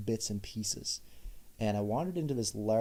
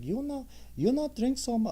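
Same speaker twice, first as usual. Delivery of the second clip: very high squeaky Chinese accent impression. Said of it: "but in a Chinese accent".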